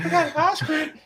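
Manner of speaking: high laughing creepy offer. Pretend. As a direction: tempt him, high, through laughter. Joining the creepy voice bit.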